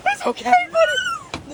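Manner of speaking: soothing a scared dog. Real, not acted.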